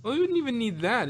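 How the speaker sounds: ordinary talk, nothing marked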